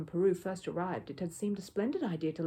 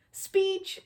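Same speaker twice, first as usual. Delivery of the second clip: much higher, louder call; crying out; 13 semitones higher, 7 dB louder than usual. A narrator's reading. Guest at the back calling for a speech, loud. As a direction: shout it from the back of the room. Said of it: "cried someone at the back".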